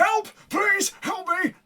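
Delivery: high, drawn-out plea; yelling for help. Acted. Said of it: "you hear him yelling".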